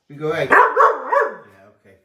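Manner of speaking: high put-on dog voice answering for him; voicing the dog. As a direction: speak for the dog in a silly high voice. Voicing the dog's reply.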